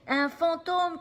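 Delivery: high, loud scared cry; frightened shout. Acted. Sammy shouts in fright at the ghost. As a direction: yell it in panic. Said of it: "crie Sammy".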